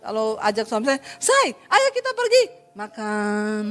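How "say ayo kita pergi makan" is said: slow, gentle, falling end; practised soft invitation. Shown as a demonstration. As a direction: try to sound sweet and soft. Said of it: "belajar juga mempraktekkan". (ajakan lembut dan pelan, nada turun)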